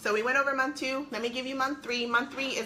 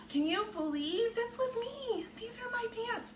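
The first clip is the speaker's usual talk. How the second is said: amazed; 12 semitones higher, 4 dB quieter than usual. Real, not acted. Showing her old big pants.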